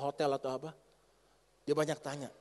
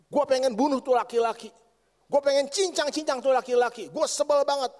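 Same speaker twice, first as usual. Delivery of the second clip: furious husband; 3 semitones higher, 5 dB louder than usual. Retold or imitated. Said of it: "dia ngomong gini".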